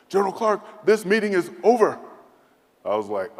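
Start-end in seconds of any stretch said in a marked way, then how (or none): 0.1-2.0 higher, louder dismissal; curt general. Retold or imitated. General dismissing the envoy, higher and loud. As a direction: end the meeting as the cold general.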